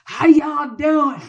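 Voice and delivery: booming, drawn-out Southern greeting; hammed-up loud Southern drawl; Southern surgeon. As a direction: holler it high and slow with a Southern accent. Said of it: "the surgeon's like".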